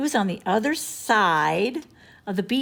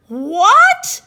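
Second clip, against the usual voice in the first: shocked what; 12 semitones higher, 12 dB louder than usual. A narrator's reading. Shocked at blackmail.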